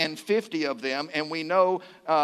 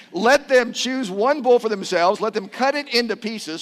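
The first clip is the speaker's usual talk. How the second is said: loud, raised proclamation; Elijah; 5 semitones higher, 6 dB louder than usual. Acted. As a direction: lay down the challenge to the crowd. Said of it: "Then Elijah said to them".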